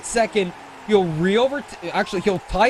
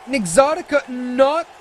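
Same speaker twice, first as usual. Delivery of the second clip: excited commentary; 7 semitones higher than usual. Real, not acted. Commentator reacts to twist finish.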